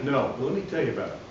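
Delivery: ordinary talk, nothing marked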